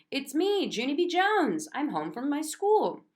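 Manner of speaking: put-on high, eager child voice; bursting with news; excited child announcing herself. A narrator's reading. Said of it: "very excited".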